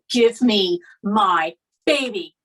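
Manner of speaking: slow, loud, word-by-word insistence; forceful demand. Retold or imitated. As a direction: re-enact a mother demanding her child, drawn out and loud. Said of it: "That comes out. Like, I would never normally talk to you like that".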